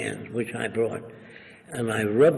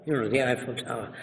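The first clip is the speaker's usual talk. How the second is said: ordinary talk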